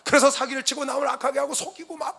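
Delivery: loud, high-pitched pulpit exhortation; real impassioned sermon shout; full-force preaching. Real, not acted. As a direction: proclaim it fervently to the congregation.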